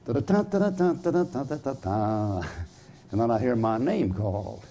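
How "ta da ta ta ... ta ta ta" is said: trumpet imitation. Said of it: "I'd hear that thing go".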